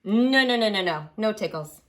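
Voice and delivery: ordinary talk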